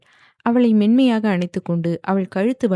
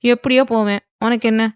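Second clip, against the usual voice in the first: higher, louder, snappish; irritated retort; 4 semitones higher, 4 dB louder than usual. A narrator's reading. Wife irritably brushing off her husband, snappish. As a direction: brush him off in annoyance. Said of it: "என்று எரிச்சலாக கேட்டாள்".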